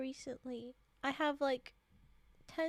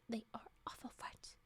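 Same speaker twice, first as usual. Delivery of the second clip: whispered line; 3 semitones lower, 12 dB quieter than usual. Acted. Reading a quote, sudden whisper.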